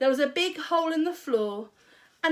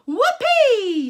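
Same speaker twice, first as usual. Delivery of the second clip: much higher, louder gleeful whoop; shouting whoopee; 12 semitones higher, 12 dB louder than usual. A narrator's reading. Grandma whooping as she grows. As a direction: whoop with wild glee. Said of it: "she shouted".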